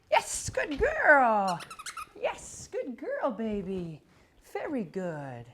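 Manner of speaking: reward her with your voice, stretched and bright; gushing dog praise. Real, not acted. Praising dog after correction.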